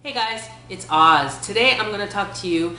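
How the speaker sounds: ordinary talk, nothing marked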